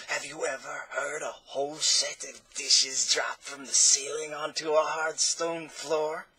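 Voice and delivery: slow, strange voice from the dark; hoarse voice. Acted. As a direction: drawl it out from the dark interior. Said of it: "a hoarse voice inquired".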